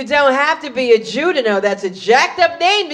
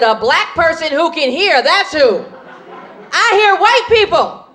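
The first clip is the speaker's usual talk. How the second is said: raised-pitch indignant retort; sassy comeback; 4 semitones higher than usual. Acted. Overheard guest answering her in-laws, indignant sassy comeback. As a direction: fire back so they know you overheard. Snapping back at overheard whisper.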